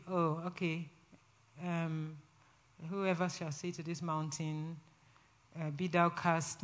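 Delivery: very soft, low, hesitant mumble; sleepy, without conviction. Shown as a demonstration. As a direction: read it half-asleep, with no belief in it. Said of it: "Not in a sleepy way".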